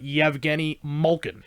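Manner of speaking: Yevgeny Malkin slowly, Y onset and MOLE-kin; proper pronunciation. Shown as a demonstration. Explaining the proper way, Yevgeny Malkin slowly as MOLE-kin. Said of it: "that's how everybody pronounces it here now".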